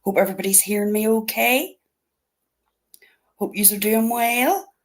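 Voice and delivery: ordinary talk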